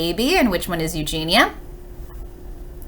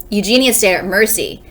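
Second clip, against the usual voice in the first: ordinary delivery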